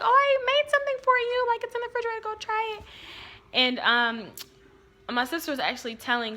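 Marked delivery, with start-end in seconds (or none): 0.0-2.8 much higher, sing-song offering voice; high, sugary invitation; sweet little-kid voice. Retold or imitated. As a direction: chirp it like a proud kid showing off. Kid telling family about her food.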